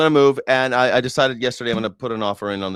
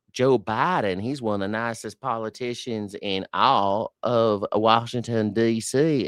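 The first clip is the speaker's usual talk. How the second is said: a hammed-up Graham gushing; Lindsey Graham impression. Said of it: "where Lindsey Graham says".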